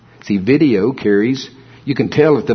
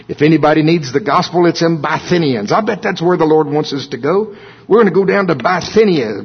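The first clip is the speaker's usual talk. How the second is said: eager Silas; 6 semitones higher than usual. Retold or imitated. Voicing eager Silas planning trip.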